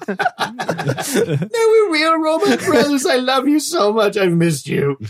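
high, drawn-out tearful wail; hammed-up weepy declaration of love; mock crying. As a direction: blubber it slowly to a long-lost brother. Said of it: "he's crying".

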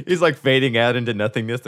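normal talking voice

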